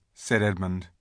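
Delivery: ordinary delivery, nothing marked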